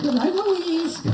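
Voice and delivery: squeaky high puppet voice, shouted out; a cheeky dummy yelling hello from the box; ventriloquist dummy Bob. Acted. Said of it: "we're gonna do some ventriloquism here".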